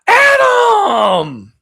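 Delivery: put-on high shouted name, slow loud gushing old voice; high loud delighted elderly lady; excited old lady. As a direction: greet him like you just noticed him. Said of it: "she'd be like".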